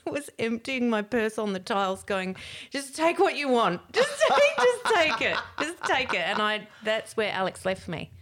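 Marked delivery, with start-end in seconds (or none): 2.7-6.2 high, loud, laughing offer of money; drunk pleading. Retold or imitated. Drunk on the floor, emptying her purse for the babysitter, high and loud. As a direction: push the money at them, tipsy.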